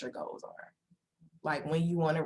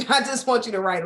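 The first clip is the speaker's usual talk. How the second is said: laughing; 6 semitones higher, 5 dB louder than usual. Retold or imitated. Client quote, laughing.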